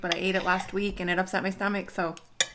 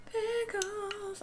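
much higher and softer, tiny squeak; squeaky little voice; 13 semitones higher, 8 dB quieter than usual. Acted. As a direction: coo the word like a cute secret. Repeating pickles in tiny voice.

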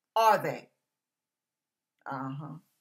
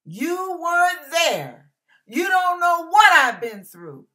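raised voice, stretched words; impassioned quote; 7 semitones higher, 7 dB louder than usual. Acted. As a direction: proclaim it with testifying force. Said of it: "as the Alabaster song goes".

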